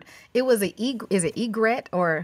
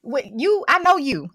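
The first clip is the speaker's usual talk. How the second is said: higher, louder mimicry of a surprised shopper; imitated startled recognition; 6 semitones higher, 4 dB louder than usual. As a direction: act out suddenly recognizing someone. Acts out shopper recognizing her voice.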